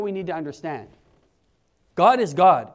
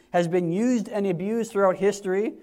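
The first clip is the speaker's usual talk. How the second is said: usual speaking voice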